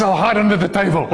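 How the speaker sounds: laughing punchline; amused retelling. Retold or imitated. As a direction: deliver the answer breaking into laughter. Joking retold answer, laughing after.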